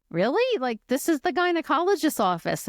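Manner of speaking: "Really?" shot up high, stunned; incredulous. Retold or imitated. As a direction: pitch jumps up high in disbelief. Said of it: "I said".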